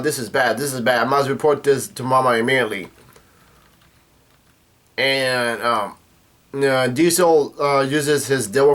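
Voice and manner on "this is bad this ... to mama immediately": ordinary delivery, nothing marked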